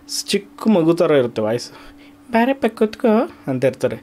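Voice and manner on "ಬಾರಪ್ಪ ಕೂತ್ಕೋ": high, put-on granny voice calling a child over; old granny voice. Shown as a demonstration. Dubbing teacher demos an old granny's voice age. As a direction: invite the kid to sit like a sweet old grandmother. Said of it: "ಕೆಲವ್ರು ನೋಡಿ ಅಜ್ಜಿ ಥರ ಇರ್ತಾರೆ".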